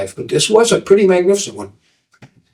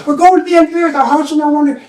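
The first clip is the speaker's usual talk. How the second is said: high-pitched call passed along; relayed proclamation; 11 semitones higher, faster than usual. Acted. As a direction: echo the answer down the line. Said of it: "on and on it goes, one to the other".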